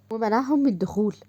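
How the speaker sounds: ordinary delivery, nothing marked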